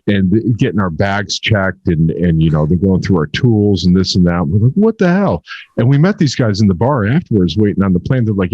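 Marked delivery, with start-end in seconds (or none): 4.7-5.4 sharp high disbelieving outburst; mock outrage at the airport search; incredulous. Airport traveller griping to friend, high incredulous what the hell.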